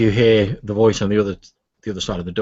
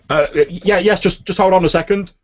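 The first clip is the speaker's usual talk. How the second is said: high, loud, caught off guard; startled scholar calls through the closed door; flustered call; 10 semitones higher, 6 dB louder than usual. Acted. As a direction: answer the knock in a fluster, higher and louder. Startled old man answers door.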